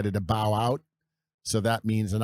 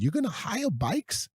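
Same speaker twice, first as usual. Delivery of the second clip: put-on Australian accent; 12 semitones higher than usual. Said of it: "I heard this Australian accent".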